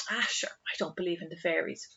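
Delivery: whispered, offhand scoffing at superstition; dismissive whisper. Retold or imitated. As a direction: breathe it out like a shrugging sceptic. Mocking dismissive sceptic.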